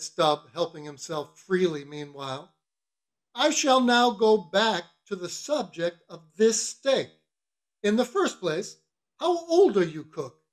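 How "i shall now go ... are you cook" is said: bossy mate. Acted. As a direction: interrogate the cook with ceremony. Stubb voiced, questioning the cook.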